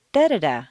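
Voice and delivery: first "da" stressed, two quick ones falling after; stress on first syllable. Shown as a demonstration. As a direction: punch the first "da". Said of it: "keeping the same intonation pattern".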